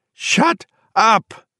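high, sharp shrieked order. A narrator's reading. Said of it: "she shrieked".

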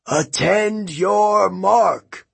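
high, long-drawn starting call; slow, high announcer's shout; shouted command. A narrator's reading. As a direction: call the racers to the line, stretching each word. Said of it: "he shouted".

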